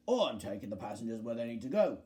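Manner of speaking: fast, low-voiced retort; low bus character. Bulgy answering Frank's challenge, fast and low.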